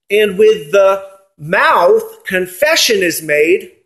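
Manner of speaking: "confession" stressed, line read slowly; emphasis on confession. Shown as a demonstration. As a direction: stretch out "with the mouth confession is made". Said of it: "I put the emphasis there on the confession part".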